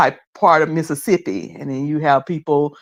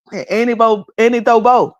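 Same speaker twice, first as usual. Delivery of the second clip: put-on Geechee accent; 6 semitones higher than usual. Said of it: "Where your Geechee accent at?".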